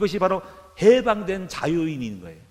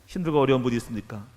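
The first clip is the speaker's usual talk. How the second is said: normal talking voice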